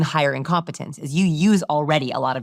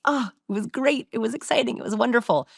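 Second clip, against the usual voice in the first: a higher, lightly gushing recollection; excited recall; 6 semitones higher than usual. Retold or imitated. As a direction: mimic someone lighting up as they remember. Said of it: "something they were like".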